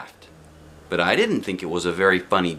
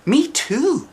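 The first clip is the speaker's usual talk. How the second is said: eager agreement; 3 dB louder than usual. A narrator's reading.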